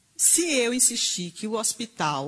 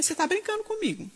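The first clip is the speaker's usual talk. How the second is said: disbelief; 9 semitones higher, faster than usual. Retold or imitated.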